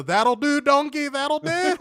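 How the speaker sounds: high, loud, slow mock Scottish; Scottish accent. Threatening the co-host with a viewer's Scottish accent. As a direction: ham up a Scottish brogue. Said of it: "If he hits you with a".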